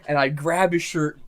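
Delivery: usual speaking voice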